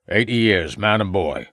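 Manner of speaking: lightly voiced rustic old man; a hint of an old country farmer. A narrator's reading.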